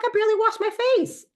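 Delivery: exaggerated squeaky complaint, quickly; mock protest. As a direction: protest in a comically high voice. Retold protest at skincare steps.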